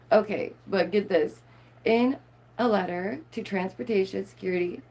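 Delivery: normal talking voice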